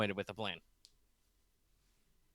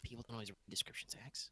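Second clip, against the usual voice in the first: whispering; 16 dB quieter, faster than usual. Real, not acted. Quick aside to co-host.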